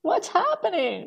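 high-pitched, drawn-out astonishment; mock disbelief. Real, not acted. Guest reacting to no chapter on Zoot Suit, mock disbelief. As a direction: act scandalized, pitched right up and stretched out.

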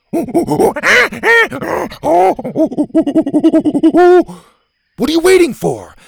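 high loud call from the door; impatient shout. A narrator's reading. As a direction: yell across the bus to Bo. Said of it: "Gavin called from the door".

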